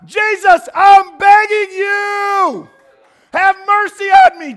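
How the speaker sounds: shouted high, pleading cry to Jesus; desperate begging prayer. Acted. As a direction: beg at the top of your voice.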